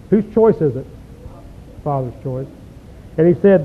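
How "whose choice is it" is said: usual speaking voice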